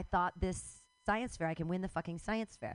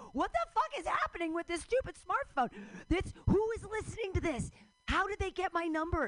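exasperated rant; 9 semitones higher than usual. Retold or imitated. Ranting at the spying smartphone.

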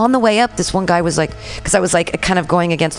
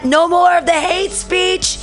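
high, drawn-out mock yell; retold window yell; 12 semitones higher, slower than usual. Neighbor yelling out the window at an open mic, mock yell. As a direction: imitate a yell, pitched way up and stretched. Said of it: "someone yells out their window".

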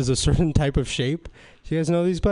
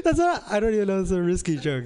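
laughing; 4 semitones higher, 3 dB quieter, faster than usual. Real, not acted. Cracking up at own joke.

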